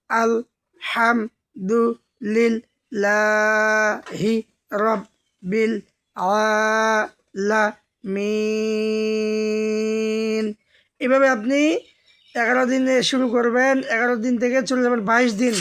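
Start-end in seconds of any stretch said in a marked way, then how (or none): 0.1-10.5 each word drawn out very slowly, one at a time; slow chanted Quran reading; broken slow recitation. Shown as a demonstration. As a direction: recite word by word with long pauses. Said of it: "ভাঙা ভাঙা উচ্চারণ করবেন".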